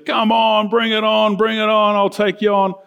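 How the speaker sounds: high, sustained mock challenge call; taunting giant. Giant daring the Israelites to fight, mock taunting. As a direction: goad the enemy in one chanting run.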